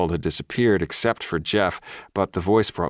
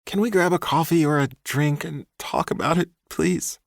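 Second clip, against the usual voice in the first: much higher, wheedling man's voice; 11 semitones higher than usual. A narrator's reading. Ex boyfriend wheedling the woman he stalked. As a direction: sweet-talk her into lunch. Ex begs.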